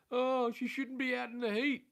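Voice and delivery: put-on high, slow fussing old lady voice; mocking mother-in-law. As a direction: hoog en traag zeuren als een bezorgde schoonmoeder. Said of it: "and she's like".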